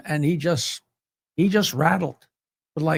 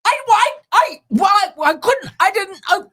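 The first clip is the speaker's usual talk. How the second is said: high, loud, broken-up excuses; mock-panicked phone stammer; flustered stammering; 11 semitones higher, 6 dB louder than usual. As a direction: sputter helplessly while being yelled at. Acts out flustered side of phone call.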